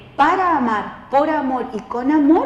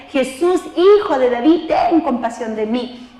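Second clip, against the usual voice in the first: higher, louder pleading cry, rising on Jesús; desperate shout; 3 semitones higher, 5 dB louder than usual. Retold or imitated. Preacher voicing the blind beggar calling louder to Jesus, loud desperate plea. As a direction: cry out to be heard over the crowd. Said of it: "gritaba aún más fuerte".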